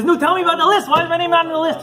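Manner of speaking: high, loud demanding plea; urgent plea. Retold or imitated. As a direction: press him for the answer. Rich man pleading about list.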